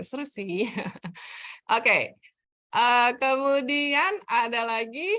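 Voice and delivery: ordinary talk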